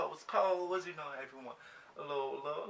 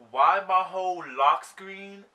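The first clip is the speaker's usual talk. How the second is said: usual speaking voice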